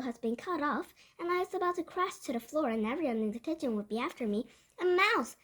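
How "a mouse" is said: raised, higher cry of alarm; shouting. A narrator's reading. Kitchen staff spot the mouse, shouting. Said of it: "they were shouting".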